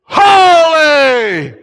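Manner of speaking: huge high, sustained shout; crying out. Acted. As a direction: shout it long enough to shake the temple. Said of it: "So loud that it shook".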